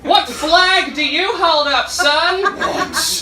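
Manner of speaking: high, loud, slow American questioning; hammed-up American demanding an answer; mock American accent. American questioning comic's loyalty, loud mock American accent. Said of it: "where an American ... she was like".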